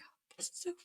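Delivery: very soft, nearly whispered; hushed inner thought. Retold or imitated. Woman recalls thinking it was finished, hushed. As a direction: let the words drop to a faint breath. Retelling her fearful private thought.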